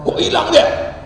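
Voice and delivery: high startled question; mock alarm. Group notices the man is missing, mock alarmed.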